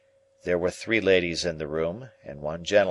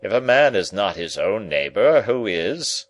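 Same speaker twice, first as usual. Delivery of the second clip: gentleman voice; 6 dB louder than usual. A narrator's reading.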